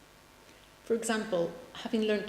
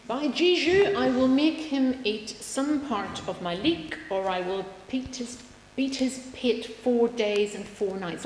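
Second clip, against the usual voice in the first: put-on Fluellen read with a loud burst, no Welsh accent; Fluellen quoted; 5 semitones higher, 6 dB louder than usual. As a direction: declaim his threat with mild bluster, no accent. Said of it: "I won't do the Welsh accent".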